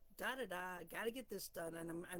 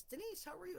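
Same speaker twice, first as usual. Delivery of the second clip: higher, breezy boss voice; a light hint of a cheerful boss; boss greeting; 10 semitones higher, faster than usual. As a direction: voice the big boss asking lightly. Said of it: "he goes".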